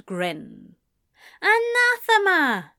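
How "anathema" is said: high, loud cry of greeting; excited cheer. A narrator's reading. Eliza spotting her friend in the shop, cheering excitedly. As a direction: call out a friend's name in delight. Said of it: "Eliza cheered excitedly".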